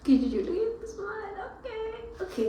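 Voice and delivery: high, breaking voice through tears; high tearful voice; crying. Real, not acted. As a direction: let the sob break the words. Crying while reassuring herself.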